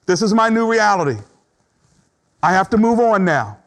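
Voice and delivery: mild acted resignation turning resolved; voicing acceptance. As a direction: settle it firmly and move on. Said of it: "acceptance says".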